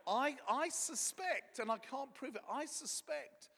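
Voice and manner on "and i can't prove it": normal talking voice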